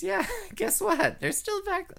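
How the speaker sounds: high, teasing voice; playful high tease. Pretend. Drag queen teasing listeners about vaccines.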